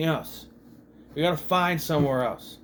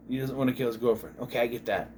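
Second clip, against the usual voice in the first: ordinary talk, nothing marked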